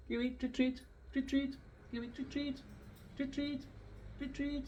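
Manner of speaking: high, soft sing-song treat treat; squeaky, soft coaxing call. Shown as a demonstration. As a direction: coax the bird up in a high voice.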